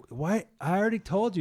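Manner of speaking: high, loud exasperated retort. Retold or imitated. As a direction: play up being fed up with repeated questions. Healed man questioned again, exasperated.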